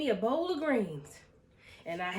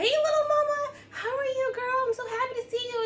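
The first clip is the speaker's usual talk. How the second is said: much higher, cooing sing-song greeting; high-pitched greeting; 9 semitones higher, 3 dB louder than usual. Retold or imitated. Owner greeting her dog in a high cooing voice. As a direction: coo at the dog in a squeaky voice. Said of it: "my voice is like that high-pitched sound".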